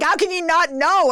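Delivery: high-pitched mock outrage; exasperated, incredulous retort; angry husband. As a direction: imitate a husband who can't believe it. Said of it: "he was so angry".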